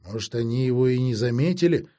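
usual speaking voice

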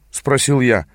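plain reading voice

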